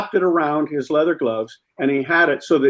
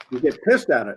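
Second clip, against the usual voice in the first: ordinary talk, nothing marked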